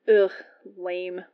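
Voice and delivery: mock-disgusted letdown; disgusted disappointment. A narrator's reading. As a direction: groan it like a bored friend. Friend is disappointed by dull answer.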